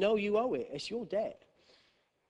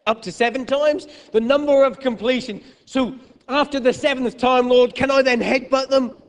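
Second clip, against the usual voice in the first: high, loud mock Peter pleading; cheeky high cry of a fed-up disciple; comic Peter; 9 semitones higher, 11 dB louder than usual. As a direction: ham up an exasperated disciple. Mock Peter asking Jesus.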